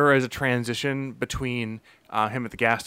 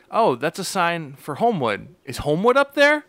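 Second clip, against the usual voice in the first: mock rising question; 6 semitones higher, 4 dB louder than usual. Mocking a character asking directions.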